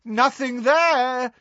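high, drawn-out taunting call; shouting. A narrator's reading. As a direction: call it out across the room. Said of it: "he shouted".

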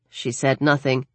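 ordinary delivery, nothing marked

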